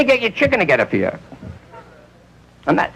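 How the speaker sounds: ordinary delivery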